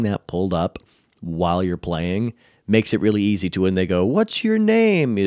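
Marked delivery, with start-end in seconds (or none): 4.0-5.2 high, drawn-out mock player question; player asking a name. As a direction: imitate a curious player, pitched way up. Voices players asking an NPC's name.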